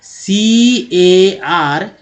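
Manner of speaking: सीएआर as slow, drawn-out "seee, ayyy, arr"; spelling out. Shown as a demonstration. Teacher writes an example for learners, slowly spelling it. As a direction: stretch each letter of सीएआर separately. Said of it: "ऐसे लिखते थोड़ी हैं".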